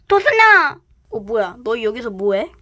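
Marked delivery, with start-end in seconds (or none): 0.1-0.8 loud high call across the room. Acted. Big sister calls loudly for the missing rabbit. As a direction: shout her name to find her.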